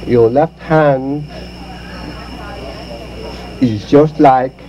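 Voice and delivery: Confucius saying. Acted. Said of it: "old Confucius saying".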